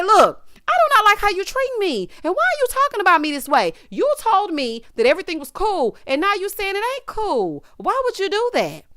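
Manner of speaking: loud high demo of plain talk; plain straight talk. Shown as a demonstration. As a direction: say it straight to his face. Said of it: "just say".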